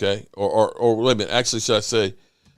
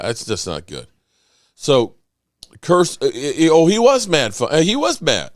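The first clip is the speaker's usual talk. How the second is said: ordinary talk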